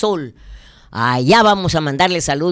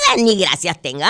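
ordinary talk

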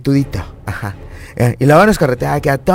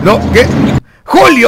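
high, loud cry of mock reproach; scandalized yell; 8 semitones higher, 3 dB louder than usual. Host cutting off a listener's dirty joke, scandalized yell. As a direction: call out the name as if appalled. Host scolds caller's innuendo.

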